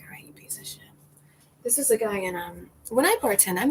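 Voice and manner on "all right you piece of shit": whispered insult under the breath; whispered retort to a rude bartender; muttered insult. Retold or imitated. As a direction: hiss it quietly so he can't hear. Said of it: "And I was like".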